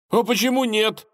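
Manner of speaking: Ivan's voice. A narrator's reading. Ivan peeking out at the dragon, high and timid. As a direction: play the nervous young lad.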